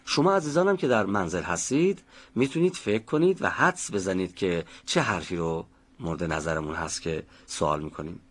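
normal talking voice

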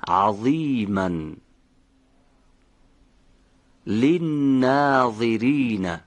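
each word drawn out with a full, voiced ظ; slow recitation. Shown as a demonstration. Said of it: "دقت کنید به حرف ظا در این کلمات".

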